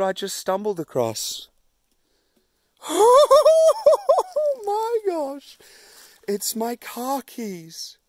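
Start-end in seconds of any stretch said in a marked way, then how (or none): 2.8-4.6 delighted laugh. Real, not acted. Finds lost car keys on trail. 4.7-8.0 high and slow with disbelief; stunned joy at a lucky find; amazed relief. Real, not acted. Hiker finding lost car keys, amazed relief.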